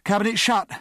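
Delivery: high, loud step call. A narrator's reading. Ager reporting to Pierce mid-drill, called out. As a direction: announce the finished step to the timer. Timed drill, calling out steps.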